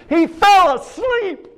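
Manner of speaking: high, drawn-out preacher's shout; emphatic shout. Real, not acted. Preacher drives home the martyr's death, shouting.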